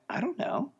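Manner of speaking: clueless reply pitched well up, imitating himself; sheepish high answer. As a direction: play it dumb, voice jumping high. Retold sheepish answer to instructor.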